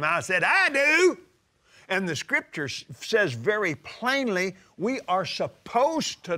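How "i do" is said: high, loud shouted answer; a sharp holler back at the TV; hollered reply. Retold or imitated. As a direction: call it out loud in defiance. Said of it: "I hollered at him".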